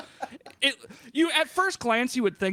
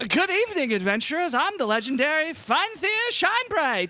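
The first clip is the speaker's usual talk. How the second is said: high, bad Valley Girl voice; attempted Valley Girl accent; 8 semitones higher, 4 dB louder than usual. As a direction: ham up a fumbling Valley Girl. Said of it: "Valley Girls".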